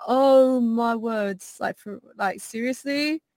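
slow, drawn-out posh English exclamation; a posh Londoner, slow and shocked; posh British accent. Retold or imitated. Posh British accent, slow and aghast, rewatching the vod. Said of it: "I'll be like".

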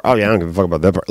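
fast dismissive mock. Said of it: "What about this part?".

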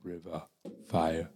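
very soft, slow mock Native elder accent; monotone Native accent. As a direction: try a hushed, monotone Native accent, pausing between phrases. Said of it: "very monotone".

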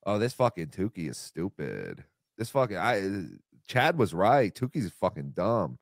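low-pitched put-on voice of a dismissive man; Kevin Brennan. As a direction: drop right down for a rough impersonation. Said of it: "I can't really do Kevin Brennan".

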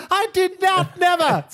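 very high, loud indignant squeal; Sir Robin protesting. Retold or imitated. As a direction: squeal a desperate denial. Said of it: "Brave Sir Robin".

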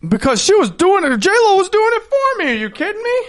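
worked-up protest. Real, not acted. Defending himself, worked up.

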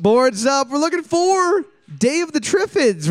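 high, bright announcer reveal; excited quiz host. Acted. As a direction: announce the answer like a game show.

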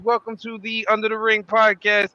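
booming ringmaster announcer intro. Acted. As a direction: call it out like a circus ringmaster. Podcast intro, ringmaster opening.

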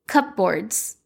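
"Cupboards" said slowly as cup-boards, sounding the p and b; careful cupboards. Shown as a demonstration. Trying the word again on her viewers, careful cup-boards. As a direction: spell out "Cupboards" as cup-boards with a rising finish. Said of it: "I know that's not how you say that word".

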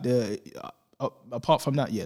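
usual speaking voice

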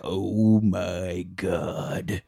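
very slow, stretched-out exclamation; drawn-out awe. Retold or imitated. Man peeking over the hill at Nazi flags, drawn-out awe. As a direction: stretch every word in mock awe.

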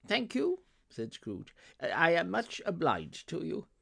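high-pitched thanks; grateful Scrooge. Acted. Scrooge thanking the gentleman for accepting, grateful. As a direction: thank him in a high, eager voice.

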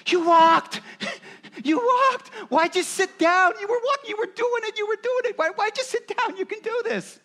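put-on high, loud cheering parent praising a toddler; excited parent. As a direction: cheer them on like a parent whose child just walked. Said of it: "what does every parent do".

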